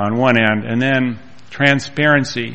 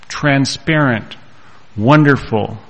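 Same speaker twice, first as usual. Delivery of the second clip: usual speaking voice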